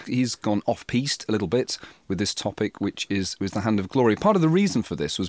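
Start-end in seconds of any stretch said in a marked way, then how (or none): none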